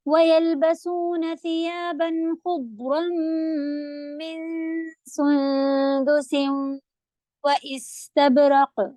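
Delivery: slow, drawn-out Quranic recitation. Shown as a demonstration. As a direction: recite the verse slowly and clearly. Models the verse recitation. (آہستہ تجوید کے ساتھ تلاوت)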